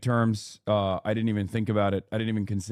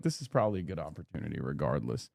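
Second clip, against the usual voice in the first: quiet fast thought; 7 semitones lower, 7 dB quieter, faster than usual. Retold or imitated. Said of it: "I was like".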